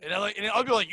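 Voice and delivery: usual speaking voice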